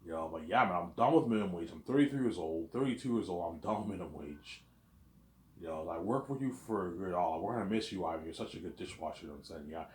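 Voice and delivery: ordinary talk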